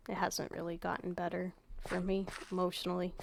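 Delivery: subdued, soft and raw. Real, not acted. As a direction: speak softly, worn down by a rough few days. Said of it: "sorry that my voice is raw".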